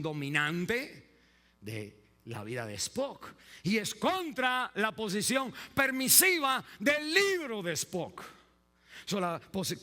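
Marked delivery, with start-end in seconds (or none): 3.6-8.2 high, loud preaching cry; preaching shout. Real, not acted. Preacher shouting his point to the congregation, high and loud. Preacher builds to emphatic climax.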